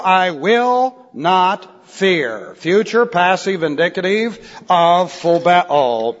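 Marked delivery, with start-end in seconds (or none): 0.0-2.5 each word set apart, very slow; measured, weighty verse reading; slow, deliberate recitation. Acted. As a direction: spell out the resolve word by word.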